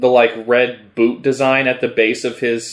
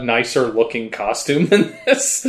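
breaking into laughter; 3 semitones higher than usual. Real, not acted. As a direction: voice giving way to a real laugh. Cracking up at the costume.

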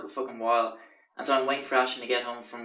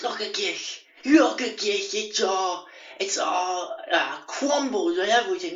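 high, strangled, outraged housemate voice; mock a man in a fussy fit; hammed-up high-pitched indignation; imitating Justin; 7 semitones higher, slower than usual. Housemate's outraged fuss over towel.

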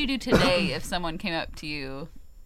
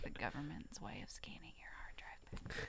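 whispering; 23 dB quieter than usual. Acted. Conspiracy joke.

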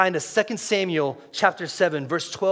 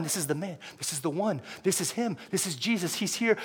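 soft, urgent, building in pitch; whisper-soft wonder, telling yourself it's him; hushed realisation; 7 dB quieter than usual. Acted. Blind man realising amid the crowd.

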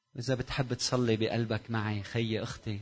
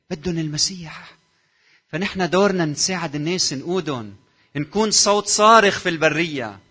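builds up louder and higher to a cry; rising preaching cry; 6 semitones higher, 9 dB louder than usual. Real, not acted. (صيحة وعظ متصاعدة)

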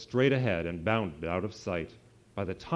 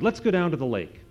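louder and higher, bouncing with excitement; eager shout; 7 semitones higher, 6 dB louder than usual. A narrator's reading. Puppy Howie urging friends to the lake, excited shout. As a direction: call the gang to the lake. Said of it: "Howie shouted".

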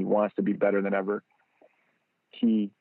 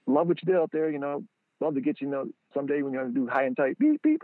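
eager pitch; 5 semitones higher than usual. Retold or imitated. Fan pitching his beeping ball to a player, high and quick. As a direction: sell it fast, then squeak the beeps. Pitching then imitating beep.

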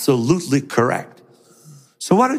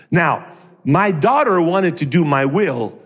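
usual speaking voice

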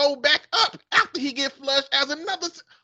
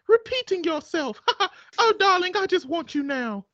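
put-on woman's British accent; 6 semitones higher than usual. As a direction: purr it back like a smitten English lady. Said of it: "man and a woman accent".

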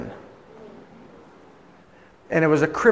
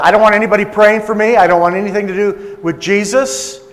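loud, raised-pitch, angry refusal; hostile refusal; 5 semitones higher, 7 dB louder than usual. Retold or imitated. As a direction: imitate a woman angrily refusing prayer. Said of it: "That's how she was talking".

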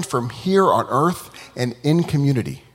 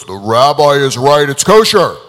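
a louder, otherworldly voice from above; booming heavenly voice; 9 dB louder than usual. Acted. Voice from heaven declaring the rabbi right, booming. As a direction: proclaim it like heaven itself. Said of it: "a big booming voice goes".

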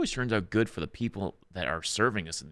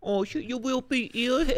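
silly voice. Retold or imitated. Silly mock doctor voice.

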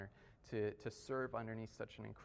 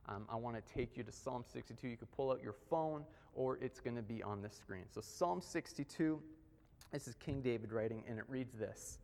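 ordinary delivery

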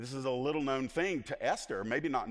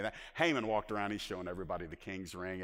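normal talking voice

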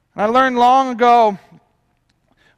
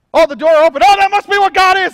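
higher, louder gushing mock believer; hammed-up excited believer; mock eager believer; 8 semitones higher, 7 dB louder than usual. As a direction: lampoon the over-eager churchgoer. Mocking over-spiritual believers.

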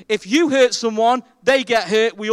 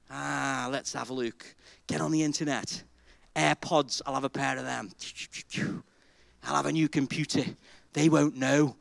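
sly private musing; 5 semitones lower, 6 dB quieter than usual. Acted. Preacher acting greedy man alone with offering.

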